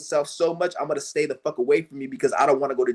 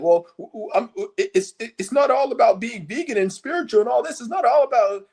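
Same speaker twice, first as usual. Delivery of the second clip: much higher, flustered stammer; mock flustered stammering; mocking stammering excuse; 7 semitones higher than usual. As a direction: play a caught-out slacker fumbling for words.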